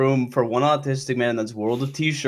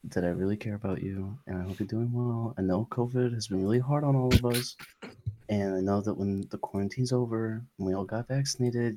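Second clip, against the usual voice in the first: mock seductive flirting; 8 dB quieter than usual. Mock flirting with Dana on air.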